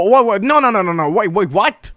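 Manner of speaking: high-pitched, stammered mock disbelief; acted disbelief. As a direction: replay your baffled refusal to believe it. Acts out finding a blank schedule.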